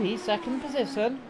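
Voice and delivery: usual speaking voice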